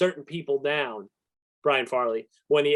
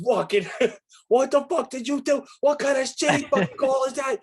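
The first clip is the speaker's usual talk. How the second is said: high laughing mock tirade; mock raging at umpire; 8 semitones higher than usual. Player acting out losing it at a bad call, laughing mock rage. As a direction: rage at the ump, half laughing. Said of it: "Oh, it's go time".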